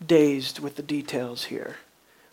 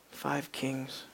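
Five kings said low and soft, leaning on Five; quiet, grave Five kings, stress on five; hushed reveal; 5 semitones lower, 13 dB quieter than usual. Real, not acted. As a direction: drop to a near-whisper for Five kings. Quiet answer about the tomb.

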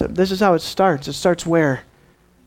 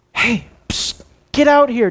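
psst, then a sharp high call; urgent beckoning; 5 semitones higher than usual. Acted. Scribes calling a disciple out, urgent beckoning. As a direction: get his attention from outside the house.

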